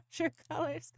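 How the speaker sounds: laughing through the words. Real, not acted. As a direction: giggle out the words. Laughing about team colors.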